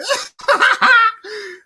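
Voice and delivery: high, gleeful cackle; laughing. Real, not acted. Laughing at disappointed Saints fans, high cackle. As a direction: burst out laughing at your own jab.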